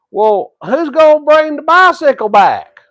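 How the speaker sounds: high, loud holler after her. Retold or imitated. Grandson hollering after grandma as she rides off. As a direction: call it out across the yard. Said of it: "I hollered back at her".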